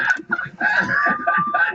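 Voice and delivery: high, loud shrieking laugh; a high shriek of laughter at his own joke. Real, not acted. Big laugh after punchline.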